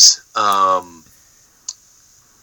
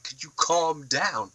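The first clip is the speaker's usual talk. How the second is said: drawn out, a bit higher and softer; slow mock calm-down; 5 semitones higher, 5 dB quieter, slower than usual. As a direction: talk him down slowly, with mockery. Mock reply to villain.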